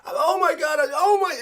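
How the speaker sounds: high, rising "oh my God" in mock alarm; imitating an emotional crypto investor; mock emotional panic. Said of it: "Look, you get emotional".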